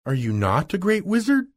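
high-pitched disbelieving question; crying out. A narrator's reading. Dorothy questioning the humbug wizard, crying out. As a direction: ask it high, climbing in disbelief. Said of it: "cried Dorothy".

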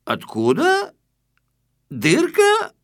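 high, flustered, stammering voice; flustered squeaky excuse. Acted. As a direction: scramble for a lie, squeaking. Flustered intruder inventing an excuse.

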